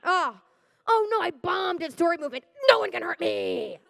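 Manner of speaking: ordinary talk, nothing marked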